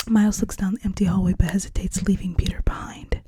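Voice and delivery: ordinary delivery, nothing marked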